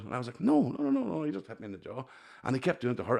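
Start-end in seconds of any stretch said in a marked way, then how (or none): 0.4-1.2 high-pitched string of refusals; mock-protest far above your usual voice; retold protest. Telling the bouncer there's no blood, high retold protest.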